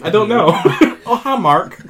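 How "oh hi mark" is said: Tommy Wiseau line. Retold or imitated. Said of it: "Why does he say it like that in the movie?".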